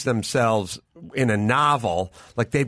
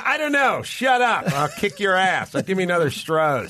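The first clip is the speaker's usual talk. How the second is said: meathead dude; 6 semitones higher than usual. Retold or imitated. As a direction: bark it out like a beer-swilling meathead. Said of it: "my friends were just kind of meatheads".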